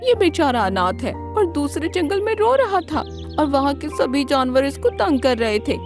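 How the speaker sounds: high-pitched storybook fox pleading a case; clever fox. Acted. Fox explains the orphan crane to lion, high fox voice.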